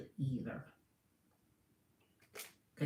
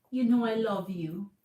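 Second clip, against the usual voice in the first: pleading lover; 4 semitones higher, 4 dB louder than usual. Retold or imitated. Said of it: "This is how he operates towards you".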